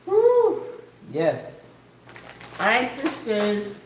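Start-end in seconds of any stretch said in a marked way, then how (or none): 0.1-0.6 louder, high woo; excited whoop. Real, not acted. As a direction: cheer out a woo. Excited exclamation.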